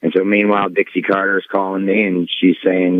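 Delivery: usual speaking voice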